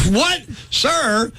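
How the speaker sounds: high-pitched mock shock; startled, pitch shooting up; incredulous. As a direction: blurt it in comic disbelief. Host acts out shock at being called sir.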